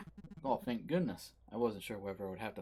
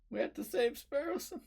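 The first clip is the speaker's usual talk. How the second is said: urgent plea; 5 dB louder than usual. Acted. Panicked plea to save friend.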